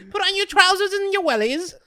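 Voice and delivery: high, loud mock British mother; bossy British mum ordering her son, laughing; British mum accent. Mum tells Blake to dress for outside, loud high British accent. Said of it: "You sound like Blake's mom".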